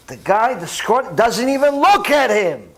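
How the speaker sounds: usual speaking voice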